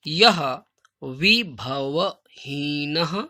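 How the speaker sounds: very slow, loud, word by word; slow teacher dictation with pauses. Shown as a demonstration. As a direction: dictate each word slowly so they can write. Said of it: "मैं धीरे-धीरे इसलिए बोल रहा हूँ ताकि आप सही से लिख सकें".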